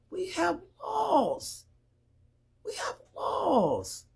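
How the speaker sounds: high, drawn-out exasperated cry; pleading, slow and high-pitched; exasperated insistence. Real, not acted. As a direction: stretch the last word high, then let it fall. Exasperated appeal, repeated for emphasis.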